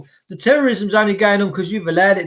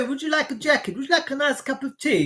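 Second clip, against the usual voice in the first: mock polite police; 5 semitones higher, 6 dB quieter, faster than usual.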